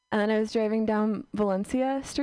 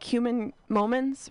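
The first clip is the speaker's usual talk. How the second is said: ordinary talk, nothing marked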